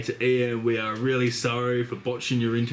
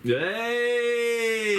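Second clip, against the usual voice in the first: excited cheer; 11 semitones higher than usual. Real, not acted. Cheering friend's pun.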